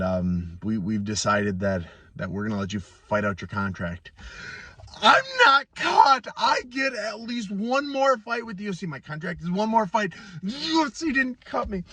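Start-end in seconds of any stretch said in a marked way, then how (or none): 5.0-11.8 excited outburst. Real, not acted. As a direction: blurt it out, overjoyed and relieved. Fighter relieved contract not cut.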